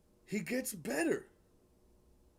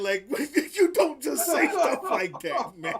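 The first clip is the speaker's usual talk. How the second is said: loud, higher, through laughter; laughing protest; 6 semitones higher, 8 dB louder than usual. Real, not acted. Host reacts to a shocking rap line, laughing protest. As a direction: protest while cracking up.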